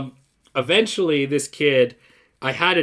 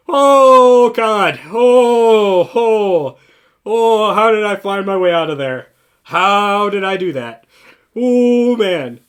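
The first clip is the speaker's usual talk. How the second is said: loud, high, drawn-out oh God of relief; loud relieved groan; 5 semitones higher, 8 dB louder, slower than usual. Retold or imitated. Outside for a smoke after the fight, loud relieved exclaiming. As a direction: groan it out like you barely escaped.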